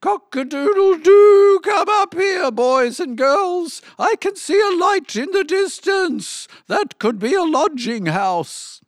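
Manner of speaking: very high, piercing rooster voice; crowing rooster. A narrator's reading. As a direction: crow it high from the treetop, starting loudest. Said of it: "Rooster Hanneman ... crowed".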